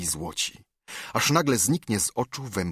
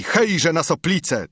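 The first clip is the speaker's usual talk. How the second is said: high, raised rallying yell; battle cry; 8 semitones higher, 4 dB louder than usual. A narrator's reading. Horsemen charging at the Soplicas, battle cry. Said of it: "tysiąc głosów wrzasło".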